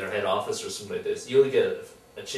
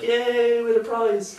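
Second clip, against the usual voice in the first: mock cheering; 12 semitones higher, 6 dB louder, slower than usual. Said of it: "it's like".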